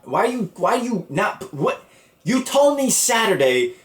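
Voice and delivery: high, bewildered protest; retold high-pitched outrage; exasperated disbelief. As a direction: sputter in disbelief at the parents. Retold exasperation at parents' surprise call.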